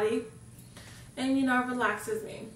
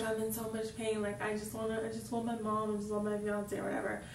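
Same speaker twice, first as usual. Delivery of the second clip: soft, weary plea for mom; pained pleading; 8 dB quieter than usual. Retold or imitated. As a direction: hush it like a patient in pain. Retold pleading while in pain.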